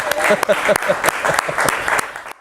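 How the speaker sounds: high breathy laugh. Real, not acted. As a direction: let the laugh run out. Host laughs after cheering.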